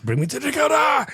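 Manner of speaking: high, loud yell with the words mashed together; all-capitals shout. Retold or imitated. Host quoting his capitals-only script dialogue, shouted. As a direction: holler it as one long word. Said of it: "all put together in one word".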